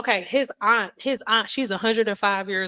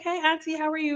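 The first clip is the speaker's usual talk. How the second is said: cheerful phone greeting; 6 semitones higher, 3 dB quieter, faster than usual. Retold or imitated. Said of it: "We be like".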